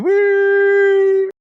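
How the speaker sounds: very high, loud whoop; mock whoop. Capping a retold joke about the wife, mock whoop. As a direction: let out a long, high-pitched holler. Caps the retold man's outburst.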